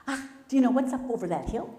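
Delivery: bouncing rabbit asking Coyote; excited rabbit. Acted. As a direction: burst out the question.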